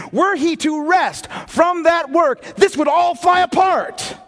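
full-force preaching, slowed; booming pulpit climax; preaching shout. Real, not acted. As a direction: shout it out slow and heavy.

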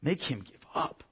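pleading prayer. Retold or imitated. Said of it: "begging God".

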